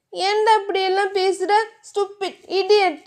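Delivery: mild tearful telling-off; scolding. Acted. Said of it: "திட்டவும்".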